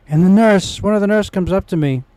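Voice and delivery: ordinary talk, nothing marked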